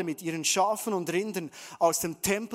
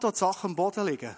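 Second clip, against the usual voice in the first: ordinary talk, nothing marked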